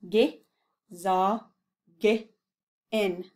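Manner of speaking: Ge, za, ge, in split with pauses; syllable by syllable. Shown as a demonstration. Teacher saying it slowly syllable by syllable. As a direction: break Gesage in into beats. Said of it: "Say that slowly".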